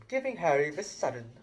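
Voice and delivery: usual speaking voice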